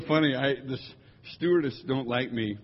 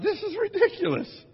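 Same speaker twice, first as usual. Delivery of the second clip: exasperated disbelief. Retold or imitated. Retold thought about a reclining seat.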